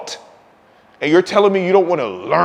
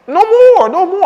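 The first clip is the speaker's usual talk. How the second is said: laughing shout; 12 semitones higher, 7 dB louder than usual. Real, not acted. Laughing exclamation.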